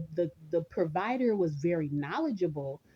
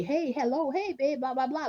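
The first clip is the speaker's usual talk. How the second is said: higher sing-song mock greeting of her husband; breezy husband impression, pitched up; imitating husband's greeting; 8 semitones higher than usual. Wife mimics her husband's usual phone hello, breezy and higher.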